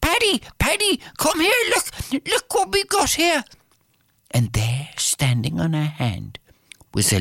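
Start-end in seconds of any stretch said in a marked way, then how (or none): 0.0-3.4 high, eager call to the husband; excited calling. Acted. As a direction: call out to Paddy, thrilled. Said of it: "brought him into the house and said".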